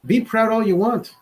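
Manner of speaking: ordinary talk, nothing marked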